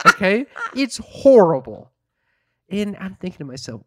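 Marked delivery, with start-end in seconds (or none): 0.1-1.9 loud, outraged, drawn-out complaint; half-shouting how horrible it was; exasperated loud rant; loud outrage. Real, not acted. Outraged complaint about cougher.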